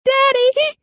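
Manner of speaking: put-on very high, loud cry of a girl; wailing yell. A narrator's reading. Chained girl calls to her father, yelling. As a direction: yell out for a parent, high and desperate. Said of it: "she yelled".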